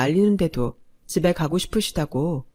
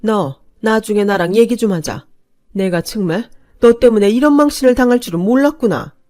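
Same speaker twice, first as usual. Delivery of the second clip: louder, seething threat building up; mother-in-law fuming at her humiliation; angry voice; 4 semitones higher, 7 dB louder than usual. A narrator's reading. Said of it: "화가 난 목소리로". (망신당한 시어머니가 며느리에게 화난 목소리로)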